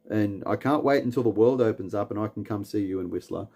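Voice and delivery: usual speaking voice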